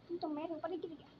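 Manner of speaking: normal talking voice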